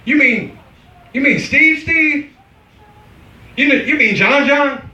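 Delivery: surprised. Retold or imitated. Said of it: "the most common names, like".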